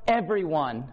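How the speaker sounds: loud, then very slow for everyone; drawn-out preacher's peak; preaching climax. Real, not acted. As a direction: stretch out for everyone. Climactic sermon line.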